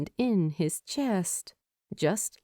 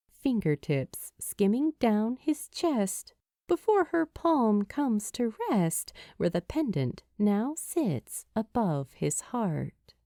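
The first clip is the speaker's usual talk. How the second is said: usual speaking voice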